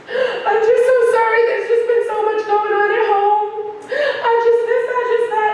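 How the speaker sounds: high, loud, hammed-up weepy apology with a laugh; mock crocodile tears. Teacher fake-crying her apology to the student, high and hammed-up. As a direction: overdo the fake sobbing sorry. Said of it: "big crocodile tears".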